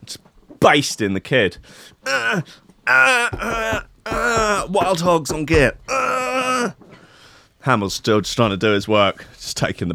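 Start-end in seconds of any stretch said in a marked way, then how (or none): 2.1-4.7 mock moan. Podcaster mimicking a colleague getting off, high mock moan. 4.7-5.8 high, whimpered mock ecstasy; mock moaning. Podcaster mimicking colleague typing one-handed, high mock moan. 5.9-6.7 high, soft mock moaning. Podcaster mimicking a colleague getting off, high mock moan.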